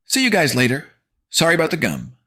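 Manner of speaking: high, loud mock-friendly goodbye; cheeky farewell. Narrator taunting bullies as he leaves. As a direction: sing it out cheekily. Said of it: "I say".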